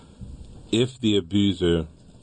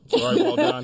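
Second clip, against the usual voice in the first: laughing. Real, not acted. Teasing partner about the reading.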